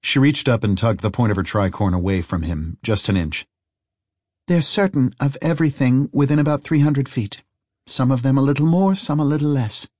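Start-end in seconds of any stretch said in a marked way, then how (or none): 4.5-9.9 put-on lightly raised, knowing young woman; matter-of-fact higher voice; Harry's voice. Harry explains the faceless men.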